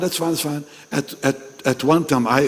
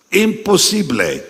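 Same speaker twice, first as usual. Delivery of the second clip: Spanish word; 6 semitones higher than usual. Shown as a demonstration. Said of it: "they say in Spanish".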